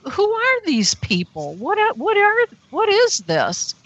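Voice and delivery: high-pitched, stumbling disbelief; bewildered. Retold or imitated. Woman wondering who these people are, bewildered. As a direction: question it in baffled, stretched words. Retells her bewildered thoughts.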